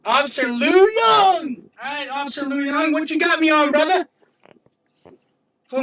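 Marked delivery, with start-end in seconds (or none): 0.1-4.1 mocking shout. Real, not acted. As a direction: taunt the trooper loudly. Mocking the trooper's name loudly.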